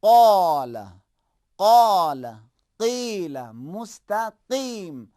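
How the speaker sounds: loud, heavy ق in قال; heavy qaaf. Shown as a demonstration. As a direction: hit the heavy ق from the back of the throat. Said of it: "قاف کو بھاری تلفظ کرنا ہے".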